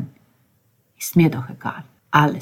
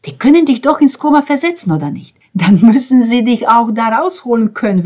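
high, loud, indignant argument; worked-up protest, high and loud; agitated rant; 7 semitones higher, 7 dB louder than usual. A narrator's reading. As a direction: argue it hotly, voice raised. Ben rants about the doctors.

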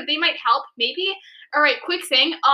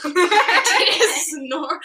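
laughing; 4 semitones higher, 3 dB louder than usual. Real, not acted. Laughing at own writing.

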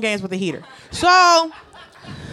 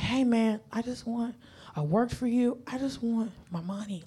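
softer hushed plea, almost breathless; hushed whisper; 3 semitones lower, 10 dB quieter than usual. Retold or imitated. Asking the boss for her pay, a hushed polite whisper. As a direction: keep it very soft and low-key, politely asking. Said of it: "I love the whisper that you've adopted".

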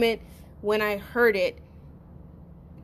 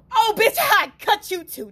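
retold threat; 11 semitones higher, 5 dB louder than usual. Retold threat, higher, louder.

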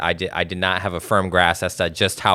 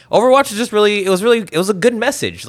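excited; 12 semitones higher, 4 dB louder, faster than usual. Real, not acted. Gushing about the game's message.

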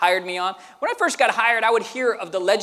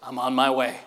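very low, softer hero voice; cool older brother; 10 semitones lower, 5 dB quieter than usual. Retold or imitated. Brother answering a call for help, low cool hero voice. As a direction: drop it low like a rescuer on the phone. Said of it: "He goes like this".

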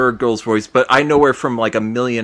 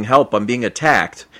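normal talking voice

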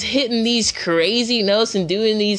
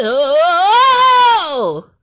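strained yelp; 11 semitones higher, 7 dB louder than usual. Retold or imitated. Imitating the strained high note.

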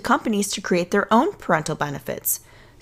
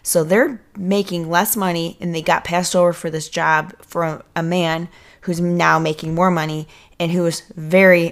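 ordinary talk, nothing marked

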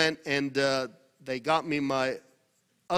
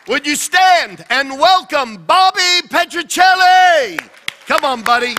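rousing guest introduction; 11 semitones higher, 16 dB louder than usual. Real, not acted. Host calling the congregation up to welcome a guest, rousing shout. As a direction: belt out the name for a big welcome.

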